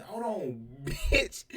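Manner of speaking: high squeal breaking into a laugh; teasing giggle on the last word; laughing mock warning. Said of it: "And I said, I said".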